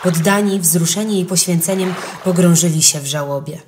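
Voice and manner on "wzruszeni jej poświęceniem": plain reading voice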